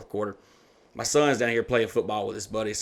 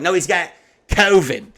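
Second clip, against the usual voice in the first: slow, high, loud correction; loud child; 7 semitones higher, 9 dB louder, slower than usual. Retold or imitated. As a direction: imitate a kid announcing it to everyone. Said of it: "like, she's so loud".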